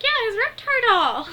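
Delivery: very high, loud, slow gloating squeal; gleeful scheming brat. Retold or imitated. Angelica gloating to Lil over the doll, shrill triumph. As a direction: squeal it like a kid who just won.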